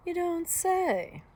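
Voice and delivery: high, drawn-out, loud feigned interest; intrigued wolf. A narrator's reading. Wolf hearing of sick Granny, slyly intrigued.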